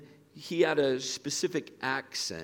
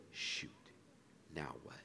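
much softer, lower imagined thought; hushed dismay; 9 semitones lower, 15 dB quieter than usual. Retold or imitated. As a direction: let it drop, as if thinking to himself.

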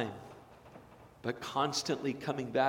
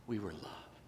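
near-whispered echo; 5 semitones lower, 12 dB quieter than usual. Acted. Repeated epitaph.

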